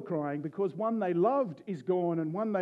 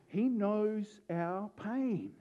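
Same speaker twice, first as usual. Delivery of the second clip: ordinary talk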